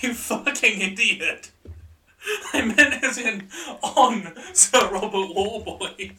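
high, loud, slow laughing insult; laughing hard. Real, not acted. Cracking up at partner.

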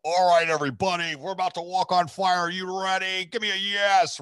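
loud, high event hype-man; rally a huge crowd from the stage; Tony. Retold or imitated. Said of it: "Tony walks ... He's like".

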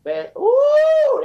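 high squealed ooh of anger; piercing ooh; exasperated ooh. Real, not acted. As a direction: let a shrill ooh burst out. Angry outburst mid rant.

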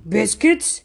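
a touch of a surprised wolf, rising; lightly voiced wolf, puzzled. A narrator's reading. The wolf hearing about biscuits, mildly surprised. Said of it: "The wolf was surprised".

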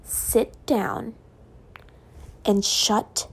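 word by word, long menacing pauses; retold snapping. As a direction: imitate a teacher's controlled snap, unraised voice. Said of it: "She had never snapped at me like that".

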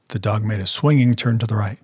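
ordinary delivery

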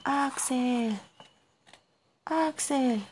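high, drawn-out call of a name. A narrator's reading. Narrator lost in the cave calls out for someone, high drawn-out cry.

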